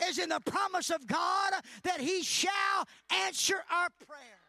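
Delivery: loud, high preaching shout; fervent pulpit preaching; full-force preaching. Acted. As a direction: preach it out to the whole church.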